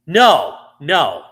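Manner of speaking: loud, firm double no; emphatic correction. Real, not acted. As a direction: cut in loudly to shut down a wrong idea. Cuts off co-host's mistaken guess.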